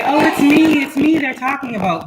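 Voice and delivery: a touch of mocking excitement; mocking eager claimer. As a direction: play the overeager viewer. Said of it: "Everybody wanna say".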